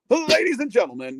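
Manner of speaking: a hint of an arena announcer's opening line; ring announcer call. Shown as a demonstration. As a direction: boom it out like announcing a main event. His ring announcer audition on phone.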